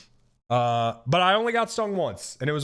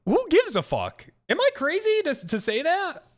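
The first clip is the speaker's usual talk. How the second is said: incredulous rant; 8 semitones higher than usual. Real, not acted. Ranting about cancel culture.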